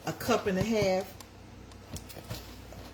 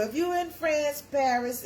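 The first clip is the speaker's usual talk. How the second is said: ordinary talk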